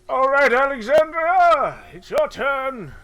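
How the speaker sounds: high, slow, drawn-out character call; Orvex. Acted. Orvex calls Alexandria to take a turn, high drawn-out voice. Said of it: "And he says".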